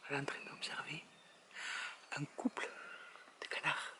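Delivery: real bird-watcher's whisper; hushed live commentary from a hide. Real, not acted. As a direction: whisper so the birds aren't disturbed. Whispering while watching birds in hide.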